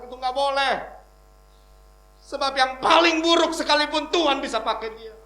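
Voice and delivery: forceful preacher's delivery; preaching. Real, not acted. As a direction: proclaim it to the congregation, building in force. Preaches in a raised voice.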